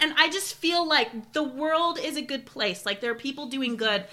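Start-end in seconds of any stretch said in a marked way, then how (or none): none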